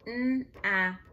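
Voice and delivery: "n-ap" in separate sounds, firmly voiced; sounding out. Shown as a demonstration. Teacher checking the spelling, sounding out. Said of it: "you wanna spell by syllable".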